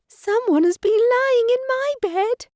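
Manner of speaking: put-on baby bear finding Goldilocks, excited squeak. A narrator's reading. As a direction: squeal it like an excited tiny cub. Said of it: "Baby Bear squeaked excitedly".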